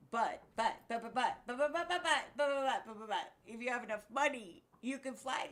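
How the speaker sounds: rising, loud stammered protest; sputtering buts. Real, not acted.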